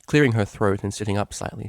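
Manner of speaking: ordinary delivery, nothing marked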